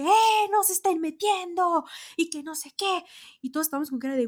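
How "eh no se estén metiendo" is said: loud, high angry yell; retold yelling. VIP fans yelling at people sneaking in, loud and angry. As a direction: yell at them to stop cutting in. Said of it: "empezaron a gritar de que".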